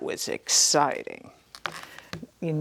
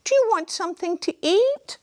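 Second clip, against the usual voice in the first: quick high kind voice; high giant's wife; 4 dB louder, faster than usual. Acted. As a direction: offer it sweet and high. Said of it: "she said".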